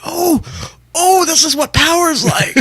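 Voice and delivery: slow, high awestruck voice; awed. Retold or imitated. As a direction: savor the power in wonder. Awed reflection on stage.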